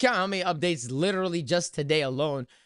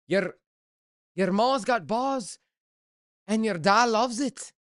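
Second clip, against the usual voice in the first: mock Scottish accent; 4 semitones higher, slower than usual. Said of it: "they roll the R's".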